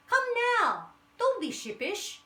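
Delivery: high, loud coaxing weasel voice; sly weasel. A narrator's reading. Weasel luring the animals toward the hole, high and sly. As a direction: coax the lamb like a sly predator. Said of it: "said the weasel".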